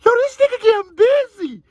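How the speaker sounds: high, loud, astonished yelp; amazed. Real, not acted. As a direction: shout it up high in disbelief. Reacting to a rapper's voice change.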